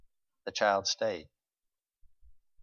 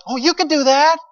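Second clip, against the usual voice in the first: much higher, gushing praise; gushing flatterer; 12 semitones higher, 9 dB louder than usual. Retold or imitated. Mocking the crowd that pumps our ego, high gushing. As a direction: coo like an over-eager flatterer. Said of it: "we want everybody around us to tell us".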